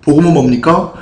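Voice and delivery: usual speaking voice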